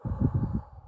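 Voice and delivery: big exhaled sigh on cue; long breath out. Retold or imitated. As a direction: breathe out slowly and audibly. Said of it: "Babe, breathe." And I'm like".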